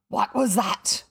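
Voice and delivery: gasp, then a loud startled demand; startled cry. A narrator's reading. Hook hears the screech from the cabin, startled cry. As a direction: suck in breath and cry out. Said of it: "cried Hook".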